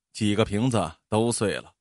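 plain reading voice

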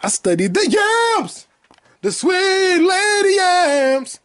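very high, very slow theatrical cry; very high, slow, drawn-out proclamation; dramatic yams line. Acted. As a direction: belt it like a sitcom bit. Quoting a movie line, sung out.

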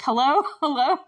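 a hint of a clueless Dewey, calling hello into a dead phone, laughing; lightly imitating Dewey's puzzled phone hello; Dewey from Scream. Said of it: "Dewey from Scream thing".

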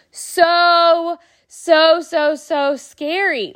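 slow, stretched so so scary; dragged-out scary. Real, not acted. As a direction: draw it out to share the fright. Recalls scary zombie hands.

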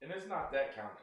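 chewing ice while talking; ice in mouth. Real, not acted. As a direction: talk around a mouthful of ice. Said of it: "I'm sorry, I'm chewing ice".

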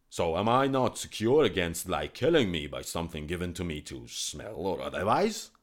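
loud, wary royal voice; suspicious king. Acted. King telling the physician why he must die, loud. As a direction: justify the killing coldly. King explains his fear.

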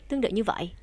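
ordinary talk, nothing marked

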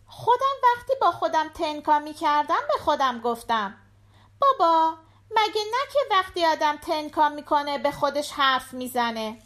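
put-on high-pitched little girl chatting to her dad; little daughter. A narrator's reading. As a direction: voice a young daughter, pitched well up. Voices the daughter. (صدای زیر دختربچه)

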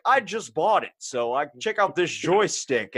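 mock teacher excuse. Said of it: "he was just like".

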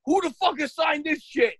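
high-pitched exasperated swearing; losing it. Retold or imitated. Dad faced with his kid's schoolwork, losing it. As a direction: retell the outburst with pitch pushed up. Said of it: "losing my mind".

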